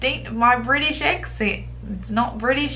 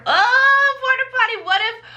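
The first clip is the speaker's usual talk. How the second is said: shocked disbelief; 10 semitones higher than usual. Real, not acted. Reacting to the porta potty hookup story, shocked and disbelieving.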